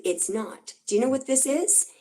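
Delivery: normal talking voice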